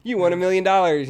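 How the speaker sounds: hammed-up lottery winner voice; mock excited announcement. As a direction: play it like a cheesy prize reveal. Acting out fake lottery ticket.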